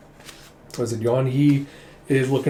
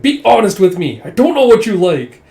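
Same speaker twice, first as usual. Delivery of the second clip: louder and higher, pushing for the truth; insistent demand; 6 semitones higher, 9 dB louder than usual. Retold or imitated. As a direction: press her hard to answer. Prince pressing her to answer.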